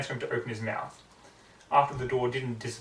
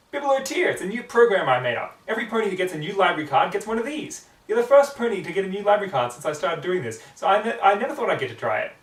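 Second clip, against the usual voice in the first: eager; 6 semitones higher, 6 dB louder than usual. Acted. Twilight eager about her program.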